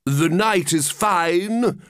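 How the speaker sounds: slow, grand small talk; pompous walrus. Acted. Walrus changes the subject from eating, slow and grand. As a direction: savour each word airily. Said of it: "the walrus said".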